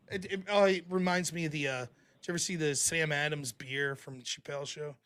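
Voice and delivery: ordinary talk, nothing marked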